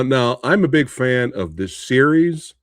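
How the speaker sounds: ordinary talk, nothing marked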